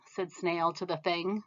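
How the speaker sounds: ordinary delivery, nothing marked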